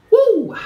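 sudden high, loud whoop of release. Real, not acted. Tarot reader whooping to shake off emotion, loud high cheer. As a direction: let out a big joyful woo.

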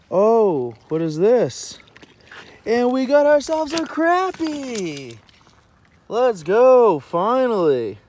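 loud, high whoop of triumph with drawn-out words; real, hollering excitement; elated shout. Real, not acted. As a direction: celebrate a long-awaited catch at full volume. Lands the crappie he wanted.

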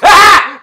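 big burst of laughter; sudden loud laugh. Real, not acted. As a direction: cackle at your own punchline. Laughs at own joke.

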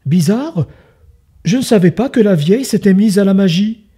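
a hunter muttering in surprise. Acted. Hunter wonders aloud.

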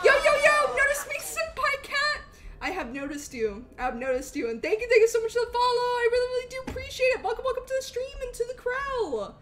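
high, loud, bubbly thank-you shout-out; bright high-pitched streamer welcome voice; excited streamer greeting. Acted. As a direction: hype up the new follower. Thanks another new follower.

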